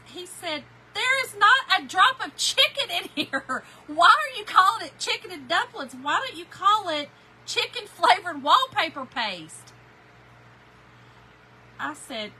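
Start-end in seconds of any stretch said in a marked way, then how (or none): 0.9-9.7 loud, high, slow indignant complaint; husband protesting the dumplings, loud and high. Retold or imitated. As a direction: belt out his outrage at the food.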